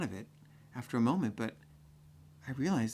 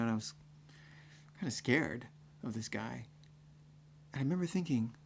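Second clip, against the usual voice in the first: ordinary talk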